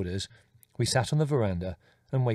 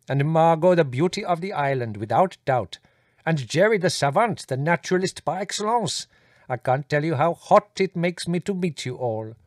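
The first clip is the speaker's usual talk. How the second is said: gushing courtly visitor, higher and eager; effusive Indian guest; 5 semitones higher, 3 dB louder than usual. Acted. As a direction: flatter each family member in turn. Voices the visiting Gigi.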